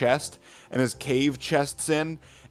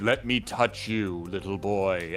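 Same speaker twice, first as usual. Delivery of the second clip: creepy slow voice; 5 semitones lower, 4 dB quieter, slower than usual. Retold or imitated. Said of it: "And he's like".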